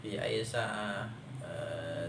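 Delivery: ordinary talk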